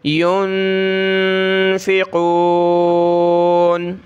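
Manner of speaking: يُنفِقُونَ with the ن hidden in a long nasal hum; very slow, nasalize the ن in يُنفِقُونَ; teacher shows ikhfa of the noon. Shown as a demonstration. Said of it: "ناک میں آواز چھپانی ہے".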